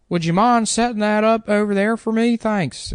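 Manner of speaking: high-pitched manager impression. Manager asking an employee a favour, high mocking impression. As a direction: mimic the manager in a high, put-on voice. Imitates manager Bill giving an order.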